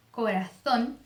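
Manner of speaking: corazón with a "th" for the Z; tongue between the teeth on the Z of corazón; Castilian Z. Shown as a demonstration. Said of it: "a língua entre os dentes". (Z interdental em corazón, como na Espanha)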